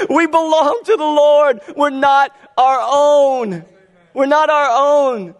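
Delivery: high, loud and slow through leftover laughter; laughing preaching. Real, not acted. Preaching while still laughing.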